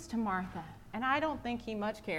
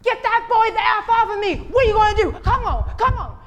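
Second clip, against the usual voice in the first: high, loud, raging shout; furious yell; 14 semitones higher, 15 dB louder than usual. Retold or imitated. Nigel yells at the fifth grader, furious shout. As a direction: scream at the kid who soaked you. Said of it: "And Nigel said".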